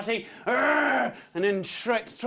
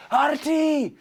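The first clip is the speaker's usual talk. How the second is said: loud, high acted yell; urgent warning shout; 8 semitones higher, 6 dB louder than usual. Shouting to Artie as the dragon rises, alarmed yell.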